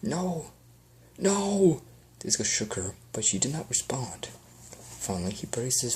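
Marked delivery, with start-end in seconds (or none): 0.0-1.8 grief building to a loud, high no; anguished cry. Acted. Grief bursts as wife dies.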